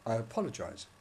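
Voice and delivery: ordinary talk, nothing marked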